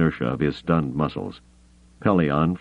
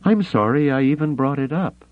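higher, rueful woman's voice; dry woman; 10 semitones higher than usual. Acted. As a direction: say it with a crooked smile. Mara voiced.

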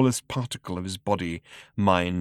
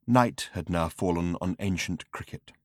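plain reading voice

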